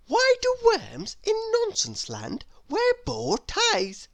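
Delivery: put-on a curious little girl asking sweetly; little Miss Curious voice. Narrator voices Miss Curious asking her door. Character voice.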